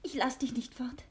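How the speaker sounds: whispered, clinging plea; urgent whispered refusal; pleading whisper. Acted. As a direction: hold him back in a whisper. Pleading him to stay.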